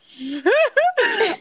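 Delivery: high burst of laughter; laughing. Real, not acted. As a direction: laugh it off, embarrassed. Laughs about offensive accents.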